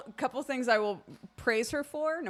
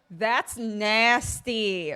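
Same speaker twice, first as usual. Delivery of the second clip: disgust; 6 dB louder, much slower than usual. Retold or imitated. Reacting to disgusting food.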